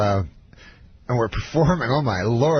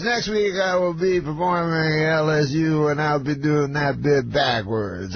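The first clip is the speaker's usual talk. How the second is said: slow, drawn-out mimicked announcement; Bill Cosby; 4 semitones higher, slower than usual. Star tells crowd next week's plan, slow mimicry. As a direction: start pitched up, stretch words, sink gradually. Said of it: "And then he goes".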